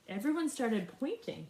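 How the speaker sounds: ordinary delivery, nothing marked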